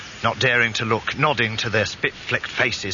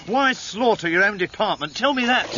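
high-pitched, outraged challenge; angry demand; 11 semitones higher than usual. Acted. Confronting the man behind the killings, angry demand. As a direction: demand an answer, voice pitched right up.

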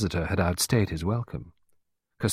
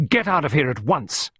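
raised, indignant command; angry order; 8 semitones higher, 4 dB louder than usual. A narrator's reading. Clarissa ordering Costello out of her house, angry. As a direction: order out an unwelcome visitor sharply. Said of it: "interrupted angrily by Clarissa".

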